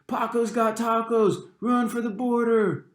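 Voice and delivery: high, slow, taunting shout; mocking yell. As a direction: jeer it loud across the lunch table. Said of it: "pointed at me and yelled".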